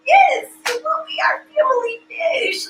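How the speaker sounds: very high, hammed-up squeal of agreement; gushing mom. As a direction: gush over your adored child. Besotted mother agreeing with son.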